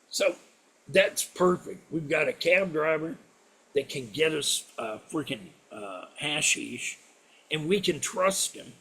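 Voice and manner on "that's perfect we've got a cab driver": ordinary delivery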